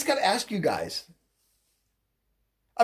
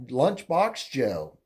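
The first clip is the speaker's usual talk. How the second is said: normal talking voice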